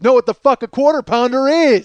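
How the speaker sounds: joining in at full voice; shouted along. Retold or imitated. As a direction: belt the famous line with the crowd. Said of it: "He says it with me".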